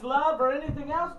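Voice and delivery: usual speaking voice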